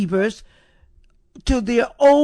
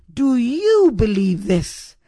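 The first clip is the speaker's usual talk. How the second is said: "Do YOU believe this?", pointed at the listener; stress on YOU; 3 dB louder than usual. Shown as a demonstration. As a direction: lean hard on "you". Question is personal, stresses who.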